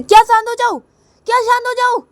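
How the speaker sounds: very high, loud mocking retort; angry shouting. Kajal rejecting Reshma's plea for calm, shouting. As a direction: throw the words back in a furious screech. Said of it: "तुम गुस्सा मत करो".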